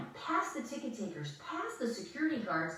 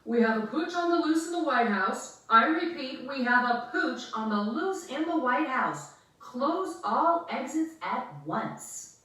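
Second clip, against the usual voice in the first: loud, higher guard on the radio; radio call; 5 semitones higher, 7 dB louder than usual. Acted. Guard radios about the pooch on the loose, loud radio call. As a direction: bark orders into a radio. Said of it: "talking into a radio".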